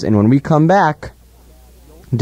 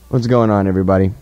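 ordinary talk, nothing marked

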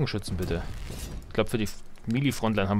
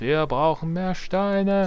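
higher, drawn-out unit call; catapult unit impression; 7 semitones higher, much slower than usual. Player showing his dream mod's catapult line, slow higher game voice. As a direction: imitate a medieval catapult crew, stretching the words. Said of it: "wenn's Katapult machst".

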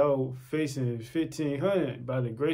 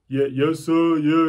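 respectful yes-sir voice, louder at the end; compliant voice; 5 dB louder, slower than usual. Retold or imitated. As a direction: answer dutifully, at ordinary pitch. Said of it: "that voice from".

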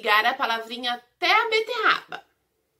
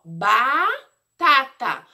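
"batata" with a long, rising first "ba"; syllable by syllable; 4 dB louder, much slower than usual. Shown as a demonstration. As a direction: draw out the "ba" of "batata", then finish it separately. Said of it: "com a sílaba ba".

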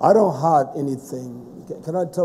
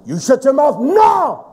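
slow then louder, higher command to shut up; retold shout; 9 semitones higher, 7 dB louder, slower than usual. Preacher retells shouting down his sister on the phone. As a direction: order her to shut her mouth. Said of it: "That's how I talk".

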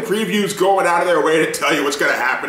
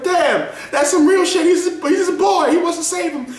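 moved fan; 12 semitones higher than usual. Retold or imitated. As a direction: say it touched and earnest. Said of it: "I was like".

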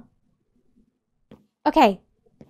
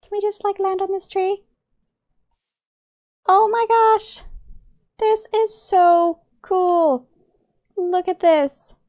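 high-pitched, breathless-with-wonder excitement; exhilarated; 7 semitones higher, 5 dB louder than usual. Real, not acted. Player flying on new Pokemon, exclaiming exhilarated. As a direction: keep it high, marvelling as you soar.

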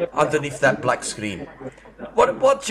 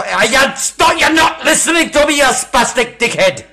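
screaming high and loud, raging at him; furious yelling; 9 semitones higher, 7 dB louder than usual. Acted. Caller losing it at the refund scammer, furious shouting. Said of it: "why did you yell on me?".

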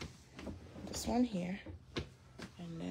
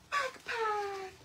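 excited squeal; 11 semitones higher than usual. Real, not acted. Gasp then excited reveal.

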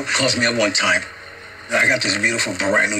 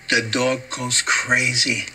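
normal talking voice

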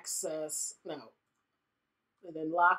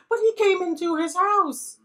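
high, loud, fake-whiny wail; mock crying; 10 semitones higher, 5 dB louder than usual. Defending Nathan with a high mock wail. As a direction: ham up a tearful protest.

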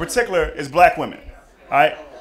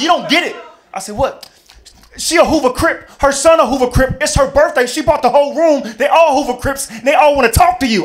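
high, loud frantic warning; panicked friend; 7 semitones higher, 4 dB louder than usual. Retold or imitated. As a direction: warn him urgently, raised voice. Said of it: "He said".

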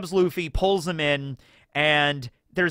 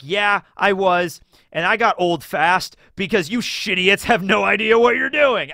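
higher, louder, slow sneering clown; sarcastic Buggy; 4 semitones higher, 5 dB louder than usual. Retold or imitated. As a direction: drag it out smugly as the clown. Buggy mocking the crew.

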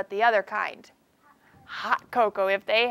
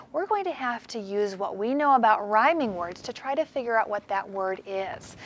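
usual speaking voice

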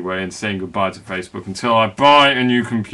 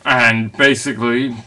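normal talking voice

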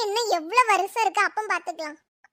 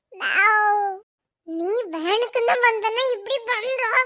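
usual speaking voice

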